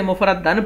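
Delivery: مفرد دن read mufradan, last vowel a; ending in -an. Shown as a demonstration. Teacher explaining the reading as haal, مفرد دن ending in -dan. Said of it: "تو یہ حال بنے گا".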